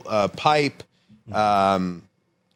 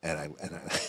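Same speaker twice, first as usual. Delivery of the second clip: normal talking voice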